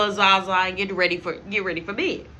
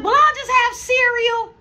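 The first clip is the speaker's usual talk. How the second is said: much higher, louder put-on voice; dad's mock retort; 7 dB louder than usual. Dad snapping back at mom, high and loud. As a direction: fire back sarcastically. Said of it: "He was like".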